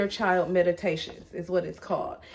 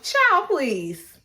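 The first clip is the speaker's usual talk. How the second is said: higher, sassy brush-off, acted out; mock dismissive reassurance; 6 semitones higher, 3 dB louder than usual. Big self retelling her answer to little self, playfully dismissive. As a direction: wave it away with a playful scoff. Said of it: "and I was like".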